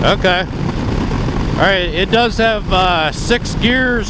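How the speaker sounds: half-yelling into the mic at speed; shouting over wind. Real, not acted. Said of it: "I'm almost yelling into the mic".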